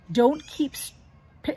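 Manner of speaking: ordinary delivery, nothing marked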